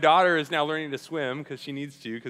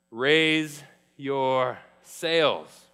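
slow repeat; 6 dB louder, much slower than usual. Shown as a demonstration. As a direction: Raise your sails drawn out very slowly. Repeats the phrase slowly.

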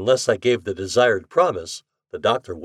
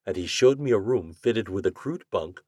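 ordinary delivery, nothing marked

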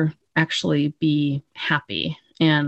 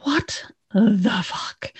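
shocked whisper; 4 semitones higher, 3 dB quieter, much slower than usual. Retold or imitated. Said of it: "And Doc's like".

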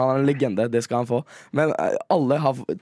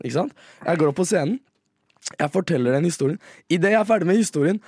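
ordinary talk

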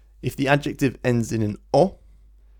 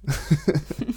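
short laugh; 5 semitones higher than usual. Real, not acted. Laughing at literal phrase.

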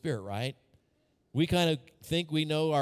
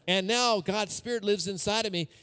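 ordinary talk

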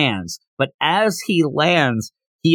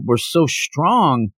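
drawn out slow and awed; slow awed musing; much slower than usual. Retold or imitated. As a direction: stretch it out in wonder. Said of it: "I think that Goku's more confused".

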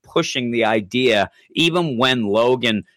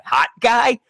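mock mishearing squeal; 13 semitones higher than usual. Landlady mishearing Hawkeye's name, squeaky and baffled. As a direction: squeak it back like you misheard. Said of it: "It's like".

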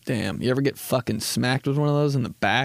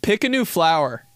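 ordinary delivery

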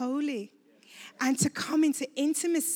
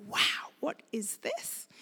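a slow, hushed wow of wonder; awed whisper; 3 semitones higher, 6 dB quieter, slower than usual. Retold or imitated. Woman recalling first awe in the secret place, hushed whisper. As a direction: whisper it like discovering something sacred. Retelling her own awe in prayer.

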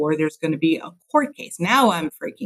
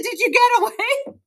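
eager mom. Retold or imitated. Mom asking son later.

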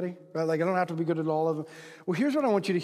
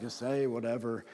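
low, soft and offhand; indifferent guy; 8 semitones lower, 7 dB quieter than usual. Acted. Guys who aren't very emotional, indifferent mumble. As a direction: toss it away like you couldn't care less. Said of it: "They're kinda like".

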